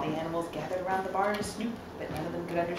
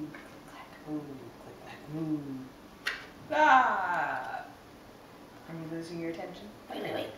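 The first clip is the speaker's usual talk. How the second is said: ordinary delivery, nothing marked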